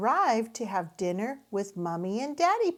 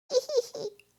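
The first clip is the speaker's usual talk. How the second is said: put-on high, bright giggle of a toddler; a small boy laughing at the dragon; little giggle. A narrator's reading. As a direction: giggle with delight. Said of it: "laughs George".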